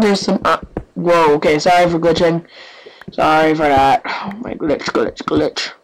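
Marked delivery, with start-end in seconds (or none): 4.0-5.7 hushed repeated whisper. Real, not acted. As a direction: murmur it under breath. Whispered mutter.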